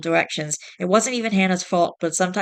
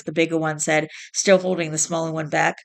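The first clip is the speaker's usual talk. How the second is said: ordinary delivery, nothing marked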